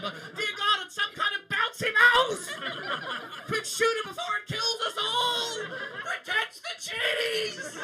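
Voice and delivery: high, slow, hammed-up panicked shouting; panicked Captain Cook. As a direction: play a terrified colonial explorer yelling alarms. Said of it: "what Captain Cook would have said".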